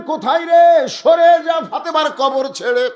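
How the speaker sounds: high shouted command; shouting out. Retold or imitated. Ali calls Abu Dhar away from the grave, shouting. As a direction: call out sharply across the graveyard. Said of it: "Abu যর বলে চিৎকার দিলেন".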